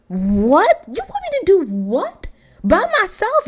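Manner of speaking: stunned that anyone would ask this; shocked disbelief. Retold or imitated. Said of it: "So Eric was like".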